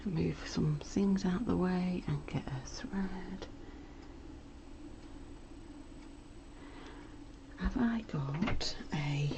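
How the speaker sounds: slow soft whisper. Real, not acted. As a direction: whisper slowly while concentrating. Whispers while threading.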